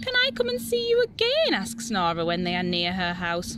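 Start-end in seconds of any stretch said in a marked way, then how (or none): 0.0-1.5 much higher, fast, excited ask; can't wait to come back; eager child. Acted. Eager child asking tiger.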